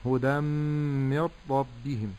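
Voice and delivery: هدى من ربهم with a long nasal m, then mir-rabbihim; idgham. Shown as a demonstration. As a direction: merge tanween into mīm slowly and nūn into rā in هدى من ربهم. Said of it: "فأقول هكذا". («هدى من ربهم» بإدغام بغنّة ثم بغير غنّة)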